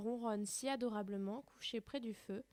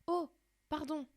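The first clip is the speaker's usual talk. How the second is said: higher sudden apology; exclaiming; 6 semitones higher, 5 dB louder than usual. Acted. Alice apologising to the offended mouse, higher. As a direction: blurt it out again, flustered. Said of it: "s'écrie Alice pour la seconde fois".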